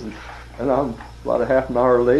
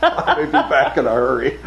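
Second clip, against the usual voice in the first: louder, higher, through laughter; laughing punchline; 5 semitones higher, 6 dB louder than usual. Retold or imitated. Rep tells boss his getaway plan, laughing punchline. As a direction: crack up while delivering the punchline.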